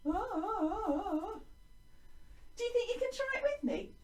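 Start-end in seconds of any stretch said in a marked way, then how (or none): 0.1-1.4 wavering ah, bobbing up and down; little up-and-down vocal wobble; wobbly "ah". Shown as a demonstration. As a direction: wobble the voice lightly on a high ah. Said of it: "up and downy".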